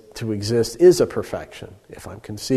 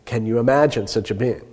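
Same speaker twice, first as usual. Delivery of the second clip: ordinary talk, nothing marked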